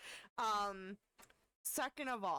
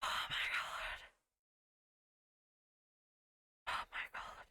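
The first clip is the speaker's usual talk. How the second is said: whispering. Real, not acted. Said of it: "I needed to pause for a second to breathe".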